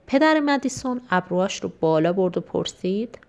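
plain reading voice

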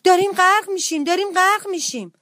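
high-pitched alarmed cry; panicked mouse. Acted. Little mouse to rabbit about sinking, in high panic. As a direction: wail it in urgent panic. Frightened mouse thinks ship sinks.

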